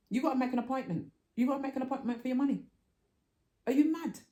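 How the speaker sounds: angry outburst. Retold or imitated. Customer yelling at the bank teller, furious. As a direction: tell the bank off at full volume.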